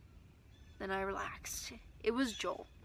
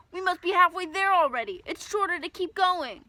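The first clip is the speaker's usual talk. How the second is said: loud, much higher shout; 8 semitones higher, 7 dB louder than usual. Acted. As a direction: shout it over the wind. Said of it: "he shouted".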